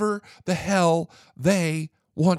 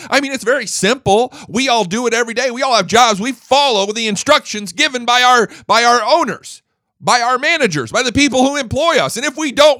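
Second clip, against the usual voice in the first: louder, higher, heated rant; angry rant; 8 semitones higher, 7 dB louder than usual. Real, not acted. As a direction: vent real fury at grown-ups breaking rules. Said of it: "I'm pissed. I'm mad".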